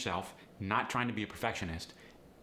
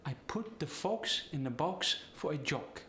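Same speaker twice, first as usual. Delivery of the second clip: higher Polish accent, long O's; 5 semitones higher than usual. Shown as a demonstration. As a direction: Polish accent with rounded O in each word. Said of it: "The short O gets the long O sound".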